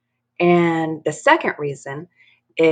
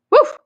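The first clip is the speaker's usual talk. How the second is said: high yappy woof; dog bark; 8 dB louder than usual. Acted. Dory pretending to be dog.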